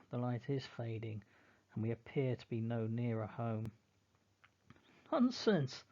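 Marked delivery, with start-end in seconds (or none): 5.1-5.8 loud, high burst masking fear; shaky nonsense. Acted. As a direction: shout it down despite fear. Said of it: "My voice is shaking".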